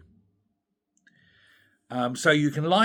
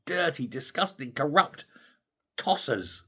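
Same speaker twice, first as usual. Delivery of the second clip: normal talking voice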